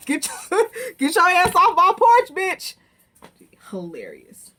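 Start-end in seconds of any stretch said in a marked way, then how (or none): 0.1-2.8 loud, high mock shout. Recapper mimicking a woman ordering people off her porch, hammed-up shout. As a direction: holler it like an imitation, high and full-voiced.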